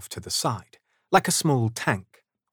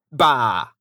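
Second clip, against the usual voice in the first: curt, louder dismissive exclamation; grumpy scoff; 5 dB louder than usual. A narrator's reading. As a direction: scoff as old Scrooge. Scrooge scoffs at the greeting.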